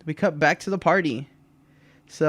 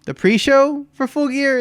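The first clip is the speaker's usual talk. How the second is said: incredulous question; 10 semitones higher, 5 dB louder than usual. Retold or imitated. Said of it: "he's like".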